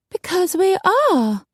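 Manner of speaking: very high, slow whine; drawn-out self-pitying reply; plaintive lady. A narrator's reading. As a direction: answer in a little helpless high voice. Coralie answers plaintively.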